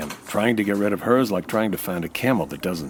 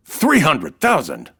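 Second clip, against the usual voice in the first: astonished; 6 semitones higher, 5 dB louder, slower than usual. Acted. Rocky hears the sum.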